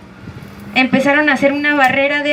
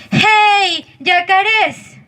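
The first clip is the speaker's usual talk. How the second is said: shouting out; 5 semitones higher, much slower than usual. Acted. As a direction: call them out from the boat. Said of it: "les gritaron".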